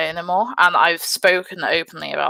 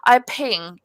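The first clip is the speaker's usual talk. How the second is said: normal talking voice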